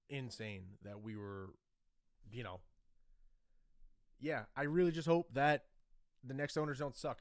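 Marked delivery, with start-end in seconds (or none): 4.2-7.2 suddenly loud and high. Real, not acted. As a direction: burst out with hope. Emotional fan hoping aloud.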